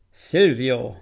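calling the name out loud in shock; a startled, loud exclamation of the name; cry out. A narrator's reading. Count recognising Silvio, crying his name out loudly. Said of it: "rief ich aus".

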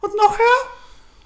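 very high, slow rising squeak. Shown as a demonstration. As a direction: push the voice way up. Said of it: "Und die wurde immer höher".

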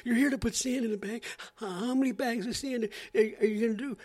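very high, shrill mock alarm; hammed-up reporter freaking out; panicky reporter. Reporter's exaggerated disbelief.

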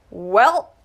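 declared loudly, teasing; loud declaration. A narrator's reading. Udell teasing Clara about her absence, loud declaration. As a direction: boom out the greeting. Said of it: "he declared".

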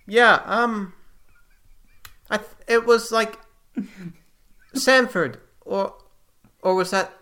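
high, halting, muddled host; Apollo, flustered. Acted. Apollo struggles to remember name.

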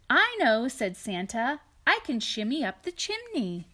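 storybook read-aloud, lightly lilting; reading to children. A narrator's reading. Parent reading a picture book to a child, gentle storytelling. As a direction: read it to a little one beside you.

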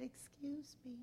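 put-on whispered like a frail old lady; soft whisper. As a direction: breathe it gently and timidly. Frail old lady approaches him.

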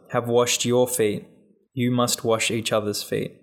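ordinary delivery

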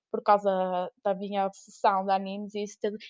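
normal talking voice

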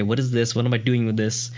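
normal talking voice

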